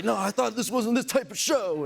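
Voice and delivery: high, loud, upset protest; whiny upset fan. Retold or imitated. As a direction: imitate a distraught hockey fan. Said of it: "and like".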